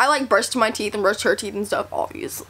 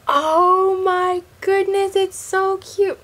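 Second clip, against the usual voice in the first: delighted; 7 semitones higher, much slower than usual. Real, not acted. Delighted at child's hairdo.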